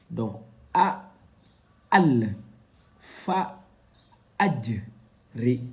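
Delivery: each Arabic syllable spoken alone, loud and clearly cut; Arabic syllables read out. Shown as a demonstration. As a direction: read the letters one by one like a Quran teacher. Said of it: "la lettre fa, elle est là". (syllabes arabes détachées, fortes et nettes)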